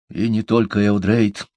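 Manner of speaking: ordinary delivery, nothing marked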